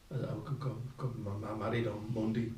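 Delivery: soft, stuttering confession; retold nervous stammer. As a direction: trip over the words, quietly owning up.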